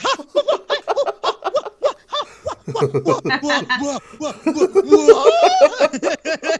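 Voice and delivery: rising, laughing what-what-what; stunned confusion. Retold or imitated. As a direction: sputter in growing disbelief. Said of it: "Tony Khan would be like".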